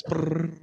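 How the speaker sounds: drum roll noise. Pretend. Host before revealing the bet's answer, mock drum roll. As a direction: imitate a drum roll with the mouth. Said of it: "drum roll, please".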